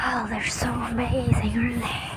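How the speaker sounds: soft, breathy whisper of wonder; awed whisper. Real, not acted. Whispering to viewers about amazing crabs, hushed and soft. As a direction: hush your voice as if the crabs might hear.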